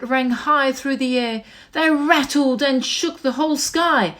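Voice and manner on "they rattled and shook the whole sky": usual speaking voice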